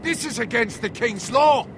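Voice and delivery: high-pitched, indignant objection; indignant protest. Acted. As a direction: object hotly, pitch right up. Acted quarrel, protesting the demands.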